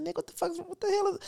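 high, quiet self-questioning; high-pitched mock disbelief; retold bewilderment. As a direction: replay being baffled by your own tears. Retold shock at own tears.

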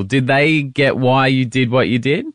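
ordinary delivery

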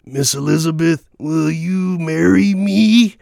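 high, slow, hammed-up wrestler proposal; mock-grand proposal on one knee; Macho Man proposing. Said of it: "he's just like".

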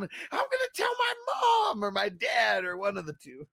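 put-on high, loud crying threat of a kid; whiny tattling kid. A pushed kid threatening to tell his parents, tearful mock wail. Acting out a bullied kid's threat.

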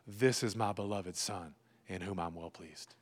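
low and soft, dropping almost to a hush; God speaking gently to a beloved child; tender, solemn. Acted. Voicing God's tender words to listener.

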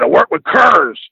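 "curs" for Coors, said with a Southern r like "kurrs"; imitating a Southern twang on "curs"; Southern drawl. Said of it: "He said".

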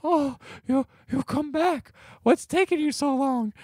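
high-pitched lovestruck reunion voice; mock swooning woman. Old lady greeting her returned Prince, mock swooning.

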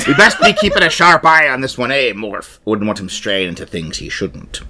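put-on conspire like a watchful old sea dog; pirate voice. Pirate warning his pet about someone, pirate voice. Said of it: "I was being piratical".

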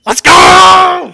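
huge high cheering yell; excited shout. Real, not acted. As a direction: belt it like a fan. Cheering the team call.

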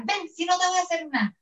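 high-pitched mock coaxing mom; threatening mom. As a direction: call the child over with menace behind the promise. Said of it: "tu mamá estaba esperándote en la casa con la chancla".

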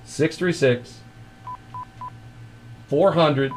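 high, drawn-out announcer read of the digits; slow spelled-out number. Acted. Host pitching the joke hotline to listeners, announcer style. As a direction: sell the number like a radio promo.

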